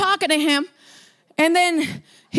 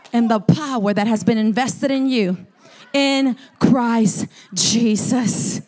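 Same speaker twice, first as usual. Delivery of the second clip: normal talking voice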